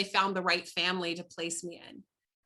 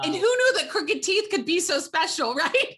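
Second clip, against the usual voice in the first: laughing; 8 semitones higher, 6 dB louder than usual. Real, not acted. Laughing about crooked teeth.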